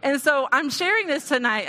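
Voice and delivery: usual speaking voice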